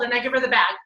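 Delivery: ordinary talk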